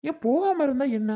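sharp, loud, high-pitched retort; angry challenge. Acted. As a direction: snap the question back in anger. Family quarrel over visiting baby. (கோபமாக, உரத்த குரலில் கேள்வி)

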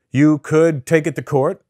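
slow, deliberate retold counsel; lawyer's measured advice. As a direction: spell it out slowly like an advising lawyer. Retells lawyer laying out advice.

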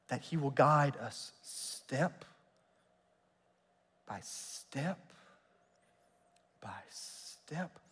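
slow, sinking from soft to a whisper; fading to whisper. Acted. Preacher eases the congregation into trust, slow fading whisper. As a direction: let each step fade away. Closing scripture line, fading.

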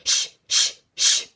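unvoiced, sharp shushes; shaking bottle sound. Bottle of pebbles being shaken, voiced as crisp shushing. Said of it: "goes the bottle".

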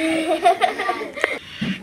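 short giggle; laughing. Real, not acted. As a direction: laugh at own take. Laughs after impression.

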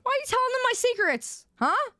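high-pitched, quick indignant demand; mock outrage. Streamer confronting chat over spilled secrets, mock-outraged. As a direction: protest in a squeaky rush.

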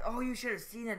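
usual speaking voice

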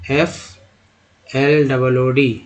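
ordinary delivery

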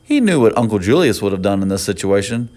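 plain reading voice

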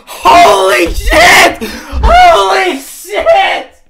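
stunned, drawn-out yell; shocked shout. Real, not acted. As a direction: shout in disbelief. Shocked by donation.

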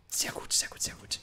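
soft, voiceless murmur to oneself; whispered approval. Real, not acted. Player approving the find, whispering to himself. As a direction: whisper it privately, pleased. Muttering to self after a find.

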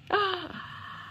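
sharp, high, hushed gasp of delight; surprised gasp. Acted. Narrator gasping for the children at the surprise, hushed and high. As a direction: gasp like you just spotted something wonderful.

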